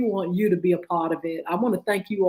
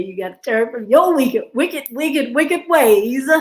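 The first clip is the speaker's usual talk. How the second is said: building preacher cry; 4 semitones higher, 5 dB louder than usual. Real, not acted. Preacher-style call to repent.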